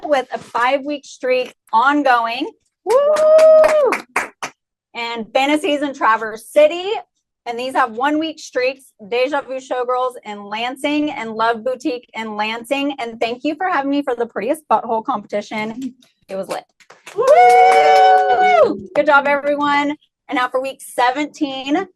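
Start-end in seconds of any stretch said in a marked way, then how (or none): 2.8-3.9 high, loud cheering whoop; excited woo. Real, not acted. Host cheering the club streaks, loud high whoop. 17.1-18.9 high, loud cheering whoop; excited woo. Real, not acted. Host cheering the club streaks, loud high whoop.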